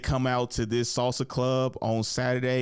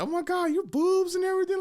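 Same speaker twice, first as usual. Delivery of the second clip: high-pitched mock girly gushing; gushing girl impression. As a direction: imitate a woman fawning over her friend. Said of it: "chicks love other chicks and love to".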